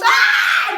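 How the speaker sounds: loud, high startled yell; startled shout. A narrator's reading. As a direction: jump with fright. Dad startled by monster.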